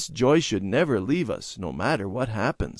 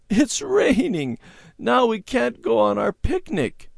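sobbing; 13 semitones higher, 4 dB louder than usual. A narrator's reading. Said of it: "she sobbed".

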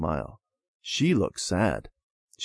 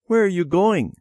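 put-on curious child; 10 semitones higher, 4 dB louder than usual. A narrator's reading. Child asks brother.

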